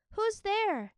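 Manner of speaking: high, loud call into the woods; shouting. Acted. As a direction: call out to a stranger. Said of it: "Minli shouted".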